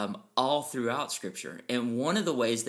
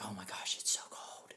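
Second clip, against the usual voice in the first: hushed, shivering complaint; whispered shiver; 3 semitones lower, 11 dB quieter than usual. Retold or imitated. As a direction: whisper it like you're freezing. Freezing cold, whispered complaint.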